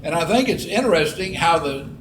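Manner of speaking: ordinary talk, nothing marked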